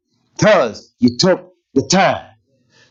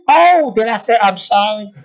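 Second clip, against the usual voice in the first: mock apology; 6 semitones higher than usual.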